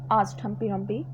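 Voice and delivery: ordinary delivery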